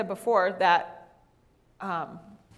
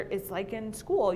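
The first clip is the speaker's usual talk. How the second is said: ordinary delivery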